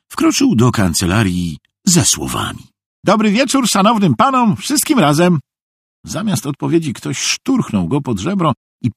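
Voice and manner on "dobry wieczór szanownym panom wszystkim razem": much higher, chirpy-polite simpleton; high-pitched eager fool; cheerful simpleton Szwejk. A narrator's reading. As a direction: greet them all in a high, naive voice. Narrator voices Szwejk greeting officials.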